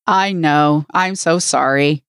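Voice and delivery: ordinary talk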